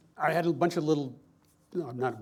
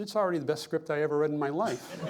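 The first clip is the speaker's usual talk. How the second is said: laughing; 4 semitones higher than usual. Real, not acted. Retold Eastwood praise, laughing.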